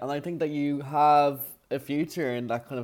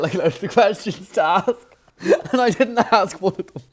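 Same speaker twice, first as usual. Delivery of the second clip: talking through laughter, louder and higher; laughing confession; 4 semitones higher, 6 dB louder than usual. Real, not acted. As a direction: laugh your way through admitting it. Amused confession about ignoring questions.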